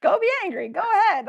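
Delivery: high sugary sing-song dismissal; mock sweet. Wife sending her angry husband off, sugary high. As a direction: wave him off in sweet sarcasm.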